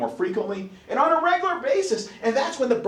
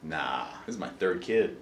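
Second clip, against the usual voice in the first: low, soft shrug-off; mock nonchalant; 8 dB quieter than usual. Mother on her third labour, mock nonchalant. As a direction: imitate an unbothered mother, much lower and softer. Seasoned mother unbothered by pain.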